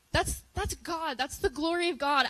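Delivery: fervent preaching. Real, not acted. To the youth group, praising God with rising fervor. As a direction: proclaim it with fire.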